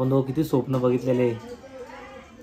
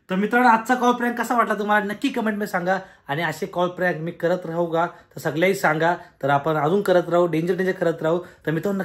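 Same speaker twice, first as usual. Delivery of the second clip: upbeat host voice asking for likes; vlog outro; 3 semitones higher than usual. Real, not acted. Vlogger asking viewers to like and subscribe, upbeat outro. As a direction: sign off to the fans cheerfully.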